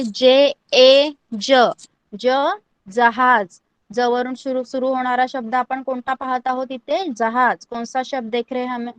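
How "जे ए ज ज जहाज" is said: spelled and said very slowly; slow demo pronunciation. Shown as a demonstration. Teacher demonstrating ज slowly for students. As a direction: draw out each sound. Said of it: "ज को कैसे pronounce करना है?".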